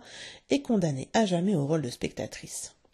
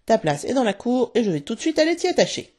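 higher, louder stern order; stern outburst; 6 semitones higher, 5 dB louder than usual. A narrator's reading. Father banishes Nana to the yard, crying out sternly. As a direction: order it out forcefully, pitched up. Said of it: "s'écria-t-il".

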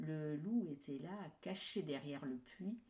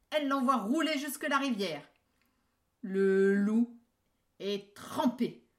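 much louder dramatic climax; excited narration; 6 semitones higher, 12 dB louder than usual. A narrator's reading. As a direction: tell the fall with gusto.

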